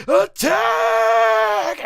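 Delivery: loud, high, long-held shout; battle cry. Acted. Villains charging at their opponent, drawn-out battle cry. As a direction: yell the charge at full voice.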